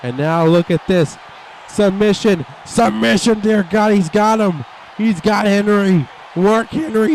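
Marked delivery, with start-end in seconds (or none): 1.7-7.1 high urgent call; excited shout. Real, not acted. Commentator calling the submission hold, shouting.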